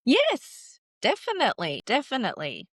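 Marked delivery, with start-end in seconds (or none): 0.0-0.8 emphatic yes. Real, not acted. Emphatic agreement.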